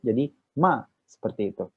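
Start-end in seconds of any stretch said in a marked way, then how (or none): 0.6-0.8 "ma" short and sharply falling; quick falling tone on ma. Shown as a demonstration. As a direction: fourth tone "ma", brief downward stroke. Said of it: "bacanya agak cepat".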